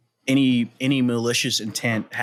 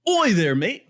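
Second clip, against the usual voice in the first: higher, lightly done Cockney greeting; a hammed-up English Oi there mate; English accent; 7 semitones higher, slower than usual. Shown as a demonstration. Friends requested the English accent, he greets them in a higher mock Cockney. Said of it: "your English accent again".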